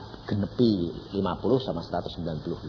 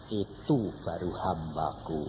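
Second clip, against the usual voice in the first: proud quiet God; 5 semitones lower, 7 dB quieter, slower than usual. Retold or imitated. God speaks proudly, softly.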